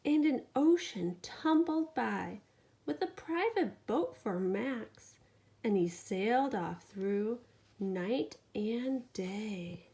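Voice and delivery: lively bedtime storytelling; animated picture-book reading; reading to a child. A narrator's reading. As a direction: read it to a sleepy toddler. Reads bedtime story aloud.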